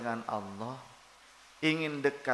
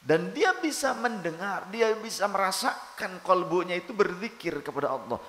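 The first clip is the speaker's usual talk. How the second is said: high, loud, fast fervent preaching; preaching at full force; 6 semitones higher, 5 dB louder than usual. Acted. Preacher urging listeners on silent zikir, preaching at full force. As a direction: drive the point home with fire.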